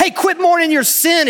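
high, loud mock order; mock command. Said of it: "it's always like".

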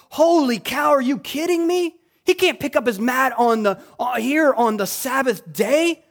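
high, loud scandalized disbelief; hammed-up incredulous objection; mock outrage. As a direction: act out an indignant religious critic. Mock outrage of Sabbath critics.

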